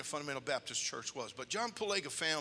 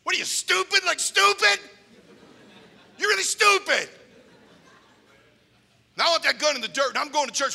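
high, loud, berating yell; furious threat; 10 semitones higher, 10 dB louder than usual. Retold or imitated. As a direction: chew out the shooter and threaten him. Palaga threatens and berates gunman.

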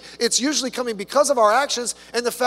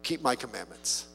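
solemn scripture reading; 3 dB louder than usual. Acted. Reading scripture slowly aloud.

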